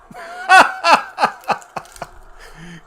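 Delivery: loud, high cackling laugh at the miss; burst of laughter. Real, not acted. Streamer laughing hard at his team's missed kick, loud cackle.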